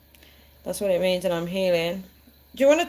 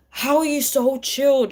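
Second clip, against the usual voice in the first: higher, slow amazed question; mock amazed; incredulous; 8 semitones higher, 4 dB louder than usual. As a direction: marvel at their calm. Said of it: "And I'm like".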